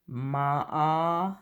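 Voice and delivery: "Ma" held on an open "ah"; isolated o sound. Shown as a demonstration. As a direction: hold the vowel in "Ma". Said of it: "is the O sound".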